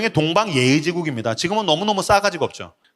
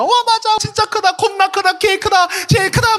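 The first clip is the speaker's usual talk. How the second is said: gushing praise. Retold or imitated. Pastor imitating his wife praising their daughter, high cheer. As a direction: cheer the child on, over the top. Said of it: "제 아내가".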